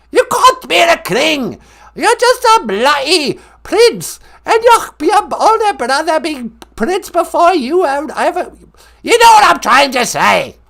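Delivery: loud, high, scolding British grandmother; hammed-up royal indignation. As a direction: scold like a cranky comic monarch. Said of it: "It's the Queen Mum".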